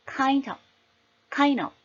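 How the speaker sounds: "kind of" in one piece, final f barely there; linked, silent f. Shown as a demonstration. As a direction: link "kind of" and leave the f unvoiced. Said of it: "이렇게 발음을 하더라도 주는 소리가 아니니까".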